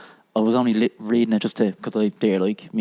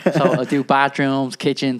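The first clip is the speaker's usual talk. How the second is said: normal talking voice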